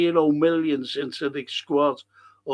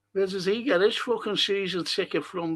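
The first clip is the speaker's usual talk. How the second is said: ordinary talk, nothing marked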